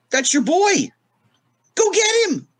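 high, hyped-up urging voice; imitating Richard Pryor. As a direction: egg him on in a squeaky high pitch. Richard's quote, urging him on.

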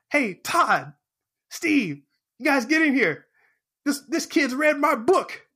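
proud call out. Retold or imitated. Proud author calling colleagues in.

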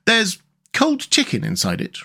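high, matter-of-fact Rat voice; brief reply. A narrator's reading. Rat answering Mole about the basket, brief. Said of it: "replied the rat briefly".

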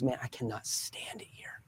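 hushed whisper, barely voiced; whispered confession. Employee secretly admitting to a customer, whispered. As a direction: whisper it so the boss won't hear.

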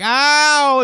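loud, high drawn-out mock yelp; exaggerated howl of pain; kid's pain cry. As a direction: wail it like an overreacting kid. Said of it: "They're like".

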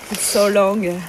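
ordinary talk, nothing marked

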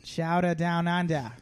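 slow Aussie-Boston hybrid; Australian-Boston accent. Acted. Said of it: "an Australian Bostonian hybrid".

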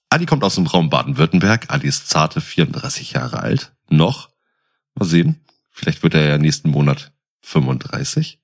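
ordinary talk

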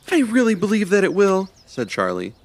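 high, bright-eyed squirrel voice; squeaky little critter full of hope; hopeful squirrel. Acted. Charlie reassuring Ivy, hopeful squirrel voice.

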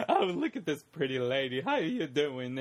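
lightly put-on flirty Hatter voice; a hint of a smarmy storybook character; Mad Hatter. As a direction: imitate a cartoon charmer greeting a lady. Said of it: "Mad Hatter's like".

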